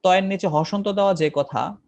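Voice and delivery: ordinary talk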